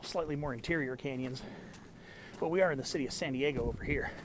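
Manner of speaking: usual speaking voice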